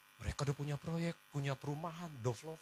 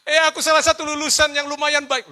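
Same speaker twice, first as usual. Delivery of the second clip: loud, high self-pitying mock wail; mock crying; 6 semitones higher, 9 dB louder than usual. Graduate turned rider complaining to God, mock wailing. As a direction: protest as if weeping, hammed up. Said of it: "saya nangis".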